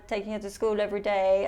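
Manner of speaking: ordinary talk, nothing marked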